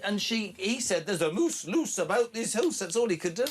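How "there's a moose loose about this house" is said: imitating a Scottish accent, pitched higher; mock Scottish rhyme, hammed a little. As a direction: Jacob Rees-Mogg doing a mild mock Scottish accent. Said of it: "we were doing Scottish accents".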